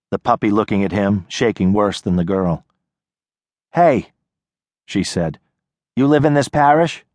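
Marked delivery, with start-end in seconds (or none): 3.7-4.1 put-on higher, guarded young woman; wary girl calling from a porch; tough city girl. Nadia calling out. 5.9-7.0 put-on higher, guarded young woman; wary girl calling from a porch; tough city girl. Nadia voiced by narrator.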